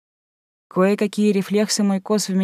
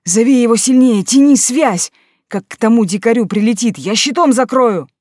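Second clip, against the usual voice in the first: louder urgent command; urgent shout; 4 semitones higher, 6 dB louder than usual. A narrator's reading. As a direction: push the order harder, shouting. Mik repeats louder, urgent.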